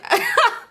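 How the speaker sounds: real laugh. Real, not acted. Storyteller laughing at her own fib, amused. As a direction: crack up briefly.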